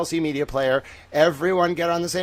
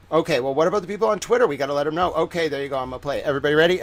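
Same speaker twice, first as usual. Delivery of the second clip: ordinary talk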